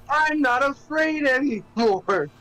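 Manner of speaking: high, loud, drawn-out child-star impression; Macaulay Culkin. Imitating a child star's movie line, high, defiant yell. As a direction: call it out high and slow, hammed-up. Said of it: "Macaulay Culkin".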